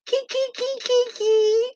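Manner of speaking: high squeaky kiki giggle; goofy giggle. Retold or imitated. Imitating a friend caught out, goofy high giggle. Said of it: "goofy laugh".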